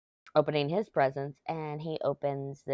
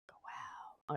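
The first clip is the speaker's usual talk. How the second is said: breathy whispered wow; 18 dB quieter than usual. Real, not acted. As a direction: whisper it to yourself in amazement. Amazed aside while sorting.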